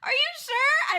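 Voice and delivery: high-pitched shriek of disbelief at a huge gift; tearful shock. Real, not acted. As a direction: cry out in stunned, tearful amazement. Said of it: "I'm actually crying".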